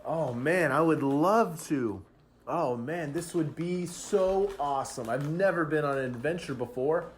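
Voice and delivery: high, excited in-character gushing; excited acceptance. Acted. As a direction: gush with eager delight. Pinecone thrilled by invitation to adventure.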